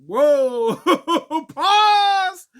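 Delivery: high, loud, laughing through the words; amused reaction to the threat, laughing high and loud; laughing whoa. Retold or imitated. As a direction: crack up mid-word. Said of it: "And I'm like".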